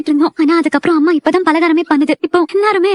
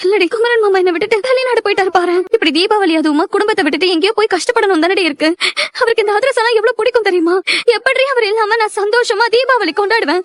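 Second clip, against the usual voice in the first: tearful, higher-pitched lament; plaintive, on the edge of sobbing; crying; 4 semitones higher than usual. Acted. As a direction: pour out the hurt of being left behind. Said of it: "இப்ப ஏன் அழற?".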